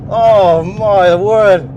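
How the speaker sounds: slow, loud, drawn-out amazement; amazed. Real, not acted. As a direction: stretch it out in awe. Thrilled on track.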